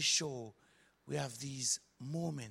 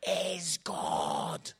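very slow, weighty pronouncement; awed declaration; 4 dB louder, much slower than usual. Acted. Preacher answering the astonished strangers, slow awed declaration.

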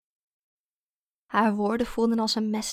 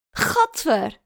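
loud, higher yuck; involuntary exclamation of revulsion; blurted disgust; 5 semitones higher, 6 dB louder than usual. A narrator's reading. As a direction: blurt it out before you can stop. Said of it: "Het schoot uit zijn mond voor hij zichzelf kon corrigeren".

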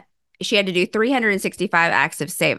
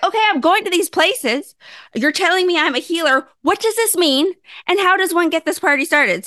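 higher, animated self-quote; eager questions; 8 semitones higher than usual. Retold or imitated. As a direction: push the questions out, keen for answers. Pleading questions to the universe.

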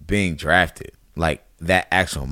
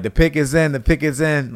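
retold yell; 6 semitones higher than usual. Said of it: "I hear him in the background yelling".

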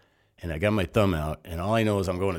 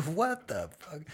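much higher laughing what the fuck; stunned disbelief; 11 semitones higher, 3 dB quieter, slower than usual. Retold or imitated. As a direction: laugh then blurt it out, high and shocked. Stunned reaction to Marine greeting.

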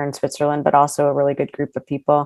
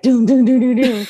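higher-pitched "doom" in a repeated run; repeated throwing noises; 7 semitones higher than usual. Retold or imitated. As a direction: imitate mindless, nonstop throwing with sound. Mimicking rapid repeated axe throws.

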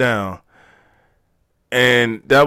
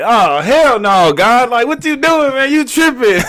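loud, high, drawn-out mock outrage; startled protest; 13 semitones higher, 5 dB louder than usual. Telling himself he's tripping over the light, startled protest. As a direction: exclaim it high in disbelief. Acting out his startled inner reaction.

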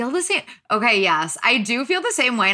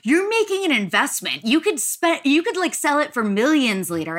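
high-pitched imitation of crypto believers; mock investment pitch; 6 semitones higher than usual. Mocking NFT believers who promise resale millions, high-pitched imitation. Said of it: "They're like".

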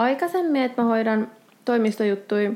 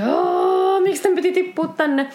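stressed lament; 9 semitones higher than usual. Acted. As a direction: wail it as if it is the last straw. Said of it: "paineen alaisena".

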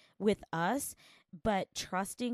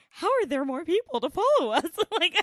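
laughing; 11 semitones higher, 10 dB louder than usual. Retold or imitated. Retelling own amused thought.

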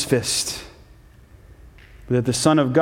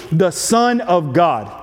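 slow weighty "Son of God", stress on "Son"; slow down and lean on "Son"; solemn emphasis on the title; emphasized; slower than usual. Shown as a demonstration. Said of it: "as if to emphasize the reality".

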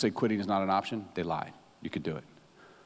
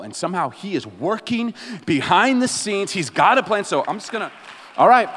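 higher, louder, driving sermon voice; full-force preaching; 9 semitones higher, 7 dB louder than usual. Acted. Pastor urging his congregation, loud and high. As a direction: preach it out to the back row.

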